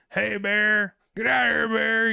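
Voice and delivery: high, loud yell at a bear; retold scream. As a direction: shout the bear away at full volume. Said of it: "this guy's fucking screaming at this bear".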